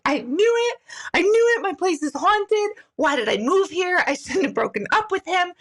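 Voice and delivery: high, wailing mock upset; imitated crying. Friend lamenting her haunted place at dinner, imitated crying. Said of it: "Now she's crying at a dinner party".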